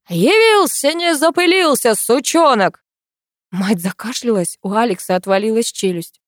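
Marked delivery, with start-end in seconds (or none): 0.1-2.8 put-on high, loud old man's jeer; loud grumpy grandpa. A narrator's reading. Grandfather greets the unwelcome guest with a loud jeer. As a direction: bark it out as a cranky old man. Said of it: "громко произнёс".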